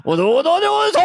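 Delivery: high, loud panicked no no no; mock Japanese panic. Host playing a man in an earthquake, loud mock Japanese panic. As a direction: yell it like a screaming game-show panic. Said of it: "I went into a Japanese accent".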